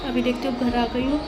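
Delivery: usual speaking voice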